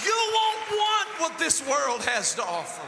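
roaring sermon crescendo over the music; preaching at full force. Acted. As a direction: build and hammer each line to the congregation.

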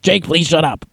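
mildly hammed-up irritation; mock mean snap. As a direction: retell a testy plea to be left alone. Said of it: "I said that in meaner and meaner ways".

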